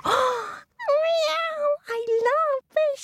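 very high mewing kitty voice; pussycat. Acted. Storyteller as the pussycat on hearing of fish, high squeaky cat voice. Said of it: "said the pussycat".

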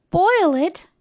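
high, startled question; aghast, pitch leaping up; gasped shock. A narrator's reading. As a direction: exclaim in dismay. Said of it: "gasped Horton".

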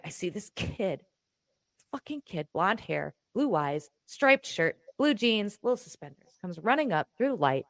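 ordinary talk

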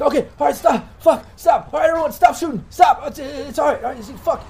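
high, loud, pleading shouts; wounded man's frantic high-pitched shouting; frantic yelling. Acted. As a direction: yell for everyone to stop shooting. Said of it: "that he was yelling out to".

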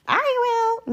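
very high little cat voice; meowing cat. Acted. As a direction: mew it like a kitten. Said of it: "meowed the cat".

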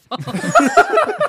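drawn-out high words into a laugh. Real, not acted. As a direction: stretch it high, then laugh. Teasing the question.

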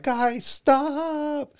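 high-pitched, drawn-out mock girl's plea; girl pleading. Girl telling slap-fighting friends to quit, high mock plea. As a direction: imitate a girl calling out in a high voice. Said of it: "the one girl that's there, she's like".

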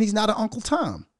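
ordinary talk, nothing marked

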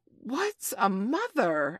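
high, very slow, baffled question; dim-witted sailor puzzling aloud; ignorant pirate. A narrator's reading. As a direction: stretch it out in loud bewilderment. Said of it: "asked ignorant Smee".

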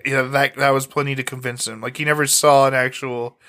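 usual speaking voice

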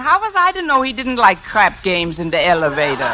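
mock-German indignant innocence; put-on German accent. Fake applicant excusing crap games, put-on German accent. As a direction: plead ignorance in a stagey German accent. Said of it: "If I can do a decent German accent".

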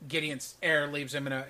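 ordinary talk

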